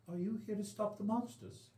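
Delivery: lightly voiced elven boy with an Elvish lilt; a hint of an Elvish accent, young and hopeful. GM voices the young elven boy asking the party for help, in a light Elvish accent. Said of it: "the accent bleed that's going on".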